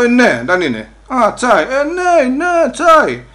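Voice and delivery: high, loud eager yes, yes, tea; excited agreement. Retold or imitated. Immigrant eagerly telling the police it's only tea, excited. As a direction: burst out happily that it's just tea.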